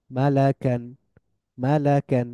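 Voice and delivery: ordinary talk, nothing marked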